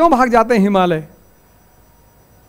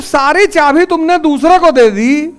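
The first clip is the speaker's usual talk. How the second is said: raised, higher-pitched rebuke; pointed reproach; 6 semitones higher, 4 dB louder than usual. Real, not acted. Teacher to student who gave all the keys away, pointed reproach. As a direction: challenge them on a high, insistent pitch.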